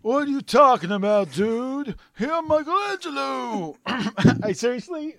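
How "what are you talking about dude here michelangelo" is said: higher, louder surfer-dude turtle voice; Michelangelo voice. Acted. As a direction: play a laid-back cartoon turtle. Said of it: "look at Michelangelo".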